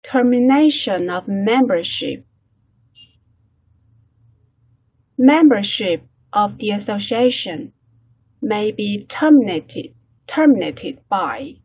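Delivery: slow, deliberate English read-aloud for learners; model reading. Shown as a demonstration. Teacher models the text for class, slow careful reading.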